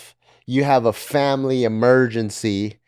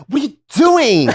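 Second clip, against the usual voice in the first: camp voice; 11 semitones higher, 5 dB louder than usual. Retold or imitated. Voicing paramedic, high.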